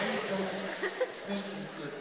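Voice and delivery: talking through laughter; giggling while answering; laughing. Real, not acted. Retold reply while laughing.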